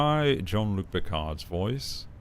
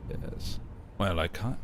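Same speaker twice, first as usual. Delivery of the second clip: ordinary talk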